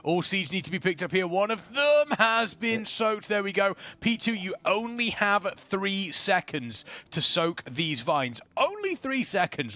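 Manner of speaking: lively esports caster read; play-by-play commentary. Acted. Caster narrates the raid boss pull for viewers, lively play-by-play. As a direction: call the fight like a live caster.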